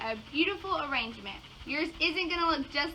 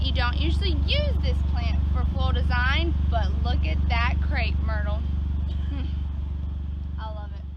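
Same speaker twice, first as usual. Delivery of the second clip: usual speaking voice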